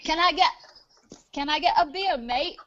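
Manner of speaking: mock Australian, rising on "mate"; Australian accent. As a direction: have a go at sounding Australian. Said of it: "ask me for a beer in an Australian accent".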